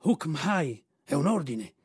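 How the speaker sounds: lightly voiced burbling camel; gurgling camel. A narrator's reading. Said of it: "aggiunse il cammello ciangottando".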